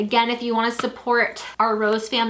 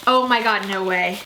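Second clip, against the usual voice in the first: ordinary talk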